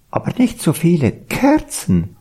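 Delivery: high owl character voice; high-pitched owl complaint; hooting owl. A narrator's reading. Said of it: "heulte die Eule".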